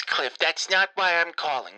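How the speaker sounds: ordinary talk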